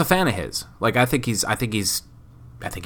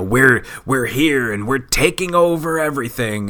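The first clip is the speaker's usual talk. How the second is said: mock takeover line; 5 semitones higher than usual. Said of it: "So whenever I hear".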